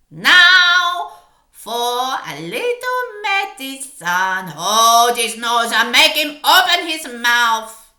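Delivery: slow, loud, strange witch voice, relishing it; gloating witch. Acted. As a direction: savour each word before the cruelty. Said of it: "and said".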